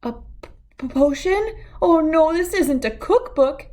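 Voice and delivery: stutter, then rise into panic; horrified. A narrator's reading. Cat realizing the book is a spell book, horrified. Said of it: "he realized with horror".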